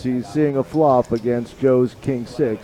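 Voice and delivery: put-on slow Brooklyn accent play-by-play. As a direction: call the hand like a Brooklyn guy. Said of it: "I don't think I wanna do the whole thing Brooklyn".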